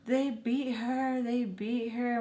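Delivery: high, soft, slow retold wail; mock breakdown. Telling my mom as I break down, imitated distress. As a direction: imitate breaking down, small and drawn out. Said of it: "I break down and I tell".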